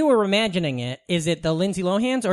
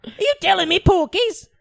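high-pitched comic British voice; Monty Python British accent; 10 semitones higher than usual. Shown as a demonstration. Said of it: "Any of your, uh, Monty Python lines".

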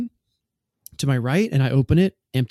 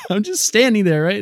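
laughing; 8 semitones higher, 4 dB louder than usual. Real, not acted. Laughs while retelling.

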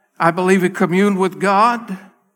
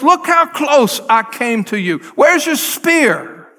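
challenging; 6 semitones higher, 4 dB louder than usual. Retold or imitated. David taunting Saul across gorge.

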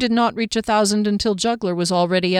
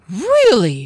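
surprised raised question; disbelieving, a bit louder; incredulous; 3 semitones higher, 7 dB louder than usual. Acted.

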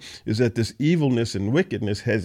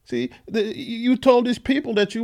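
pleading; 10 semitones higher than usual. Acted. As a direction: beg the Lord as if betrayed. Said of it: "Jeremiah is pleading with the Lord".

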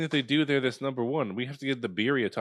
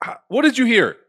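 higher, louder interrogation; grilling demand; 11 semitones higher, 7 dB louder than usual. Acted. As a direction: grill them, loud and high. Grilling the eavesdropper.

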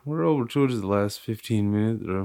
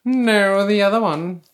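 high, loud put-on British accent; hammed-up upper-crust British; posh British; 12 semitones higher, 8 dB louder, slower than usual. Correcting which country club, mock posh British accent.

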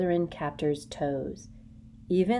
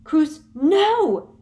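high, loud cracked cry; cracked shout; 11 semitones higher, 11 dB louder than usual. A narrator's reading. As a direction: break the shout in panic. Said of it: "shouted Hermione in a cracked voice".

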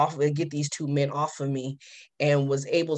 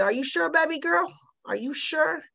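sweet-talking street call; 13 semitones higher than usual. Retold or imitated. Coaxing a girl on street.

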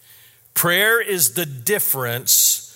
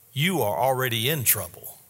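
normal talking voice

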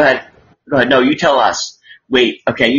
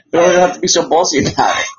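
usual speaking voice

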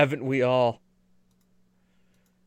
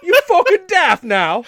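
high, loud mock-British heckle; American hamming up a British accent; bad British accent; 11 dB louder than usual. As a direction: shout it in cartoon English. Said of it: "an American's attempt at a British accent".

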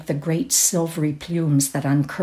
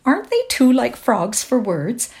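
high, louder gushing chatter; bubbly, lilting society voice; Kitty Maitland trilling; 7 semitones higher, 4 dB louder than usual. A narrator's reading. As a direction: trill it like a party guest. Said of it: "trilled Kitty Maitland".

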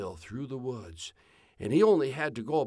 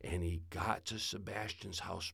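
ordinary delivery

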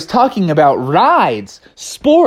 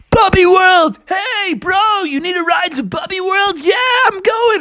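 high, eager bro voices; excited friends. Acted. Animals hyped for opening day, high and excited. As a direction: hype each other up. Everyone heading to the park.

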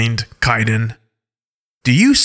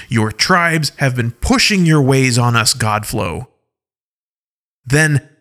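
angry; 3 semitones higher than usual. Acted. Alpha Paw ranting at the Godflow's ways, angrily. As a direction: scoff at their lies angrily. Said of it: "in a more angry voice".

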